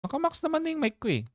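rushed, high-pitched indignant complaint; indignant disbelief. Real, not acted. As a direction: insist the mic is already maxed out. Protesting the weak-voice comment.

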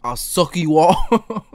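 loud, high, drawn-out delivery; over-the-top, stretched-out proposition; hammed-up come-on. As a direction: belt out the line slowly, overacting. Hammed reading of a lewd line.

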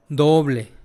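usual speaking voice

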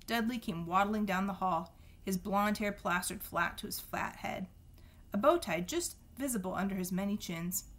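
plain reading voice

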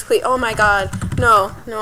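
ordinary talk, nothing marked

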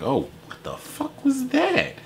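high, slow, breathy gasp of disbelief; voicing the girl in the video, high, slow astonishment; stunned surprise. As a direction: play her caught off guard by his move, mouth hanging open. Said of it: "She's like".

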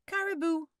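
put-on boy welcoming his friends; called out. A narrator's reading. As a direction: call the welcome across the yard. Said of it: "Adika called".

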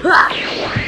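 unvoiced loud attack shout; battle yell. Fusion fighter charging in with a yell.

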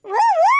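high drawn-out playful howl, oo oo; mock howl. Grandmother teases the complaining old man with a howl. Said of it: "ஊ ஊன்னு ஊள விடுதியே".

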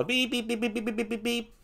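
high, soft, rapid repeated beeps; alarm beeping. Retold or imitated. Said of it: "alarms that go off".

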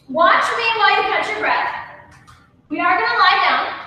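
ordinary talk

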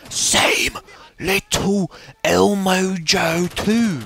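high, slow drawn-out shout; high slow exasperated shout. Real, not acted. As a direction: stretch it out in exasperation. Annoyed at same enemy.

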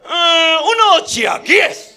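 high, drawn-out mock-Chinese order; mock Chinese diner. Chinese diner orders from the waiter, high slow mock-Chinese voice.

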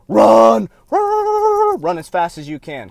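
shrieking run run run, then settling back; urgent yelled warning. Pretend. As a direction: yell run like the building's on fire. Warning listeners to flee.

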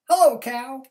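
high, loud cheery duck greeting. A narrator's reading. Duck greeting cow, high and loud. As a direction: sing out hello like Duck. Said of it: "said the duck".

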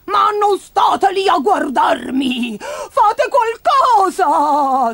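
very high, drawn-out screech; slow, wailing high shriek; shrieking grandma. Acted. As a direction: screech at the onlookers as a frantic granny. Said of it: "strillò".

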